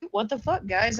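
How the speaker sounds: ordinary delivery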